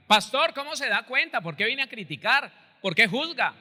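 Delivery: mock churchgoer protesting to the pastor; indignant protest. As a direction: push back hard, accusing. Imagined listener protests the pastor.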